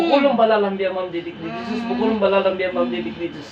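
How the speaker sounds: low, soft droning spell; low ritual drone; murmured incantation. Real, not acted. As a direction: intone it low and unbroken, under the commands. Chants a repeated spell.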